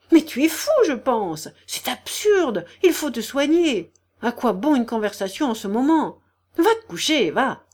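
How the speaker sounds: high, urgent, alarmed scolding; frightened cry. A narrator's reading. General's wife urging the sick man to bed, frightened. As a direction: cry out to make him stop. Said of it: "cria la générale effrayée".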